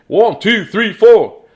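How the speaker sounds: loud count-in to the song. Real, not acted. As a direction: shout the count to start. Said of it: "counting in the song".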